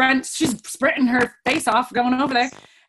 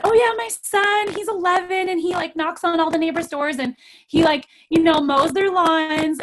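high bright bragging tone; lightly voiced proud mom; chirpy proud mom; 6 semitones higher, 3 dB louder than usual. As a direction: boast cheerfully about the son. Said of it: "I said".

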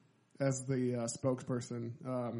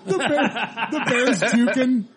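laughing; 6 semitones higher, 3 dB louder than usual. Real, not acted. Laughing at funny ad.

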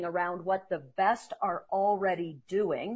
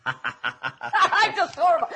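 laughing through the words, higher and louder, slow; 7 semitones higher, 5 dB louder, much slower than usual. Real, not acted. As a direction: laugh it off at yourself, drawn out. Laughing at own failure.

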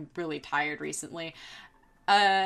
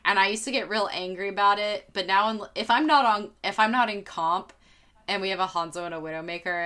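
usual speaking voice

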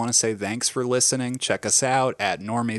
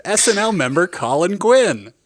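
higher, louder, slow announcer call; drawn-out announcer; 7 semitones higher, 6 dB louder than usual. Acted. Introducing Colin Quinn as a drawn-out announcer. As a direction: stretch it like a game show host. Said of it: "only say it if it's drawn out".

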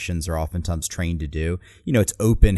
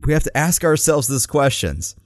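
mock grandeur; 6 semitones higher, 3 dB louder than usual. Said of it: "mocking the candidate's grand line".

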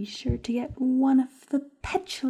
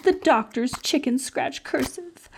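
usual speaking voice

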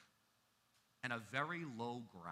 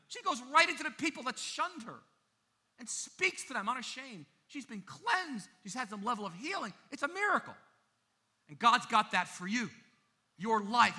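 loud, high, ringing preacher's call; full-force preaching; 9 semitones higher, 8 dB louder than usual. Acted. Pastor urging the congregation toward new life, loud preaching. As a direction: preach it out to the back row.

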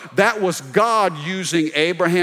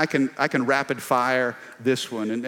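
ordinary talk

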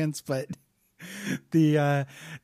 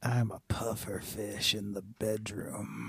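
low, soft, very slow come-on; mock bedroom seduction, deep and quiet; naughty alpha voice; 7 semitones lower, 11 dB quieter, slower than usual.

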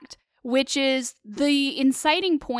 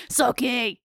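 lightly voiced, badly faked drawl of the name; terrible Bill. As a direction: attempt a bad character impression. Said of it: "I have a terrible Bill impression".

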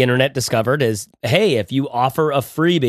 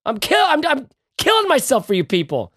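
high-pitched, strained mock exasperation at listeners; hammed-up wounded plea, pitched sky-high; mock-hurt pleading; 11 semitones higher than usual. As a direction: whine and beg, voice pushed way up high. Mock-pleading rant scolding listeners about guides.

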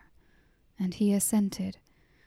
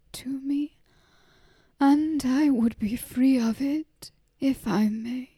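slow, weary plea of a tired mother; slow, worn-out asking, a touch higher; wearily; 3 semitones higher, slower than usual. Acted. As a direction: let each word sag with tiredness, slowly. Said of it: "and said wearily".

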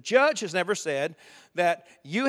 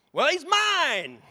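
stubborn claim; 9 semitones higher, 4 dB louder, much slower than usual. Retold or imitated. Parent claiming child from devil.

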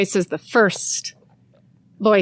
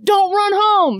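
high coach's shout; coach yelling; 12 semitones higher, slower than usual. Retold or imitated. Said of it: "the coach is like".

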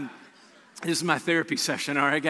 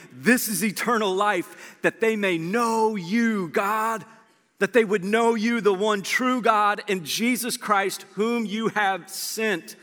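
slow, raised, earnest prayer voice; lifted, unhurried prayer; slow solemn prayer; 4 semitones higher, slower than usual. Acted. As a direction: pray it out slowly over the huddle. Jesus praying over disciples.

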